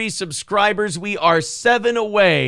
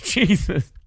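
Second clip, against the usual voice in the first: normal talking voice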